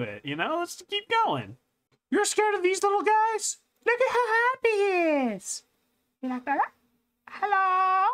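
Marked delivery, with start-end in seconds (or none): 2.1-8.1 much higher sing-song coo; high teasing baby talk. Acted. Teasing viewers scared of frogs, high cooing voice.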